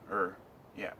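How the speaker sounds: hiccuping between words; hiccups. Real, not acted. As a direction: speak through the hiccups. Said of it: "I got the hiccups".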